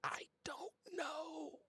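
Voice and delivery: slow, high whisper; whispered reply. Acted. Whispered reply from shoulder companion.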